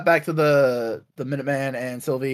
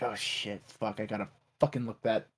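low, hushed cursing to oneself; soft, low imitation of private swearing; muttered dismay; 6 semitones lower, 11 dB quieter than usual. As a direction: mutter a worried realization under the breath. Voicing Mobius muttering to himself.

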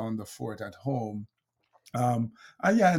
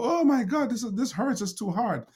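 much higher, loud complaining wail; whining boxer; 11 semitones higher, 5 dB louder than usual. Retold or imitated. Speaker imitates a hurt boxer complaining, whiny. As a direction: whine it like a beaten fighter giving up. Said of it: "he doesn't go".

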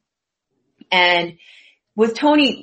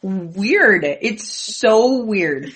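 slow drawn-out; much slower than usual. Real, not acted. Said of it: "drawn out repetition".

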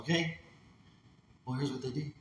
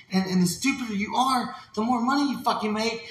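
normal talking voice

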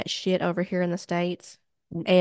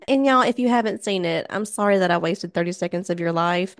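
ordinary delivery

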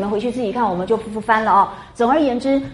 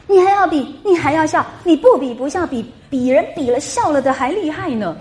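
angry retort; 6 semitones higher than usual. Acted. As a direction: fire it back like an offended girl. Daiyu's angry retort read aloud. (高声读林黛玉生气的反驳)

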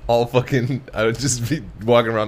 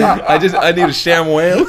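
laughing; 6 semitones higher, 6 dB louder than usual. Real, not acted. Laughing through his own joke.

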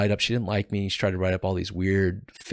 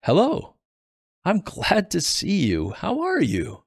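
bright, much higher cheery hello to an ex-convict; cheery greeting; 10 semitones higher than usual. A narrator's reading. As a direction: greet him like a beaming mayor. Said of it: "said in a cheery tone".

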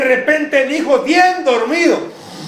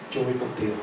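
tender God voice; 11 semitones lower, 13 dB quieter, faster than usual. Acted. Preacher voices God's promise.

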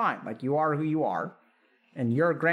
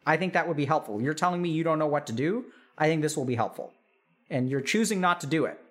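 ordinary delivery

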